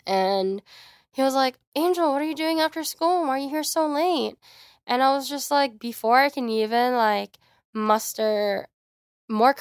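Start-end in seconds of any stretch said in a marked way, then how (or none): 1.8-4.3 higher-pitched imitation of a questioning teacher; surprised teacher. Teacher finds pupil after school, lightly imitated higher questioning voice.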